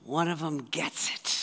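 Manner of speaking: lightly voiced, low amused aside; hint of a delighted Jesus; knowing twinkle. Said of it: "twinkle in Jesus' eye going".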